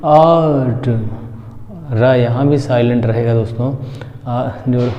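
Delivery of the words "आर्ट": आर्ट with long aa and no r; teacher modelling आर्ट; silent r. Shown as a demonstration. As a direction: say आर्ट as "aat", dropping the r. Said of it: "क्या बोलेंगे?".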